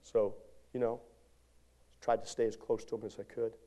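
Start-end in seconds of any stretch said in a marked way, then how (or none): none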